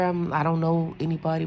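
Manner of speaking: ordinary delivery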